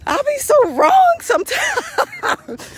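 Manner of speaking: high, slow, laughing confession. Real, not acted. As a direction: admit it while laughing. Laughing self-mockery.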